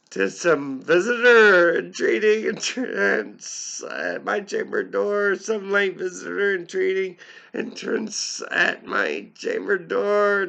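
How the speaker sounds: squeaky high quoted voice, slowed at the end; high put-on voice. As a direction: voice jumps high and loud for the quote. Narrator voicing the speaker's muttered line.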